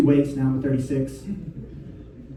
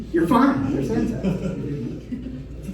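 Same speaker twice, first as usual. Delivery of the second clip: laughing; 6 semitones higher, 6 dB quieter, much slower than usual. Real, not acted. Laughing through dismissal.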